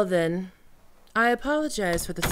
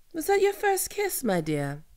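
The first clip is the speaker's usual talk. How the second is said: teasing; 9 semitones higher than usual. Acted. Teasing captive after kiss.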